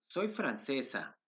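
ordinary talk

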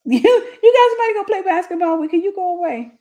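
high, loud mock pleading; hammed-up go away; exasperated. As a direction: shoo him away, fed up and high. Telling husband go away.

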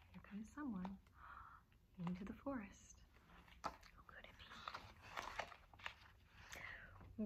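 soft suspenseful whisper. Pretend. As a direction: hush it like a secret. Hushed suspense aside.

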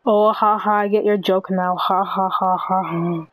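deadpan ha-has, tailing off; forced, unamused mock laughter; sarcastic fake laugh. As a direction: pretend to finally get it. Sarcastically getting the joke.

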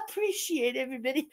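put-on hippie voice; mock hippie. Streamer mocking her own thanks to everybody, hippie voice. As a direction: thank them as a send-up hippie. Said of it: "I sound like a hippie".

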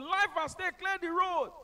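high, loud, slow megaphone call; ambulance shout. Retold or imitated. Ambulance driver clearing traffic, loud slow call. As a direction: announce the emergency over a loudspeaker. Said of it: "I was shouting".

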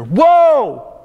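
"Woe!" burst out high and loud, held long; explosion of anger. Shown as a demonstration. Preacher showing how Jesus cried woe, explosive. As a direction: shout "Woe!" as a startling angry cry. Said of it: "like an explosion of anger".

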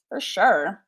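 put-on eighties Valley Girl drawl; Valley Girl accent. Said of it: "I just went into my, my Valley Girl accent".

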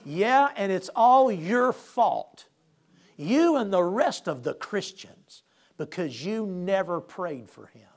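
slow, loud, dismissive rebuke; a lightly voiced stern Lord, slow and cutting. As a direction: drawl it out, scornful and weighty. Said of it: "he said".

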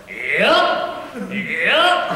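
cheeky dummy voice, lips still; lips barely moving. Acted. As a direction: puppet voice thrown without lip movement. Ventriloquist dummy voice.

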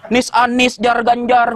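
last syllable said before each name, "Nis Anis, Jar Ganjar"; mock Madurese tally call of "Nis Anis, Jar Ganjar"; Madurese-style reduplication. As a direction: double the names "Anis" and "Ganjar" from their tail syllable. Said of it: "namanya diulang-ulang".

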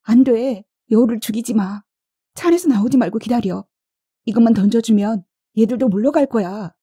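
high-pitched, pressing plea to stop; urgent storybook character under threat; urgent pleading. A narrator's reading. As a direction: call out anxiously, pitched well up. Panicked exchange during a fox attack.

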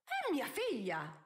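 high, drawn-out outraged cry; indignant protest. A narrator's reading. Countess objecting to the judge about her daughter, indignant.

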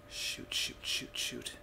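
breathy whispered curses; whispering. Real, not acted. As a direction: swear under your breath. Hiding from monsters, swearing under breath.

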